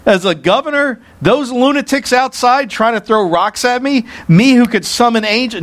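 high-pitched, scornful disbelief; hammed-up haughty indignation; arrogant. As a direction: fire off incredulous questions as if far above them. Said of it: "he could have arrogantly said".

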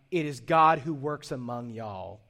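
light Texan drawl on y'all; playful Texan Paul. Pretend. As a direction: read Paul like a Texan preacher. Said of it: "So Paul was a little Texan".

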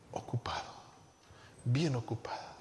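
hushed, near-whispered mock excuse; meek excuse. Churchgoer excusing absence to the pastor, acted hushed excuse. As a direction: let the excuse sink low and very soft.

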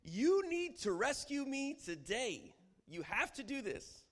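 high, loud, slow demand; strong imperative. Acted. Preacher acting a believer demanding rescue from God. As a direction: insist God rescue you now. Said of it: "It's the strong imperative".

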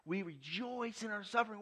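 preacher repeating with rising fervor; rising preacher's fervor. Acted. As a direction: lift it high and sing it out. Repeat much higher.

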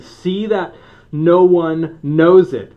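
loud, slow, stern command; stern warning. Acted. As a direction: warn them hard and slow. Jesus sternly warns healed men.

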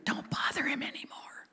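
very soft, secretive whisper; acted confidential whisper. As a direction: murmur it voicelessly into his ear. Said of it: "Comes and whispers in Jairus' ear".

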